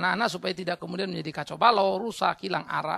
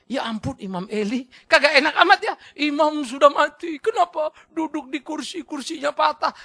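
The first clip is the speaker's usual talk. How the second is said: high, loud laughing disbelief; laughing mock reading; 8 semitones higher, 9 dB louder than usual. Reader laughs at Eli's death news, loud laughing disbelief. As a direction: read it like a joking headline. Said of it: "Kadang bacanya".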